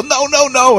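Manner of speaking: high, desperate no no no; begging it to stop; pleading protest. Retold or imitated. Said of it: "you wanna go".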